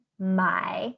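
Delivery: very slow, drawn-out Mandarin syllable; careful Chinese word. Shown as a demonstration. Teacher isolating a Mandarin verb for learners. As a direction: stretch "买" slowly with its dipping tone. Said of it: "the verb or the action".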